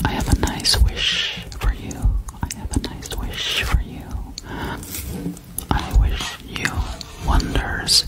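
ordinary delivery

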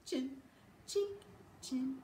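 ordinary delivery, nothing marked

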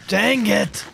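loud, high outburst of frustration; frustrated yell. Real, not acted. Player failing in the game, frustrated loud yell. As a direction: yell it out, pitched well up. Fails again, louder outburst.

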